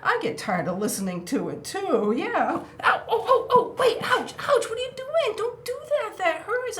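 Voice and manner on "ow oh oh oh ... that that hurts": high, loud hammed-up ouch; mock pain yelps. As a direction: yelp as if pecked, half laughing. Crow pecks her hand.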